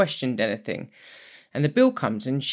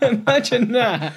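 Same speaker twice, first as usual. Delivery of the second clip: amused, chuckling through the words; laughing; 9 semitones higher than usual. Real, not acted. Laughing to the co-host about meeting Bill Nighy.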